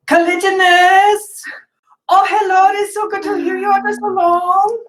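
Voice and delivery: very high, loud, gushing nun voice; Sister Obscura delighted. Acted. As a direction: squeal happily at an old pupil.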